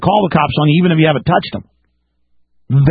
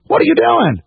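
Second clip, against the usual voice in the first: mock innocent surprise; 10 semitones higher than usual. Telling listeners how to answer cops, with mock high surprise. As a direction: pitch jumps high, wide-eyed innocence.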